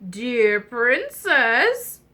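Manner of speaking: very slow, drawn-out frog voice; stretched, swooping frog call. Acted. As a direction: croak out each word slowly. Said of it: "it was the frog".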